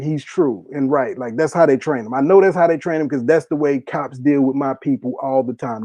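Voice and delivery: normal talking voice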